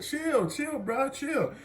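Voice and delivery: high, unforced "calm down" plea; retold placating plea. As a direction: imitate a student trying to placate. Student pleads with teacher to relax.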